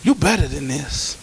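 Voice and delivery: gently reaching out to a struggling church member; gentle. Shown as a demonstration. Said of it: "go to them gently and say".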